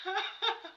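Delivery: high burst of real laughter; short high laugh. Real, not acted. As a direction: crack up. Listener laughs at robot beatbox.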